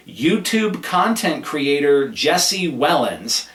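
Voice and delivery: slow, emphatic announcement of "YouTube content creator Jesse Wellens"; all in caps. Shown as a demonstration. Host reading own notes, mock all-caps emphasis on "YouTube content creator Jesse Wellens". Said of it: "I have that all in caps".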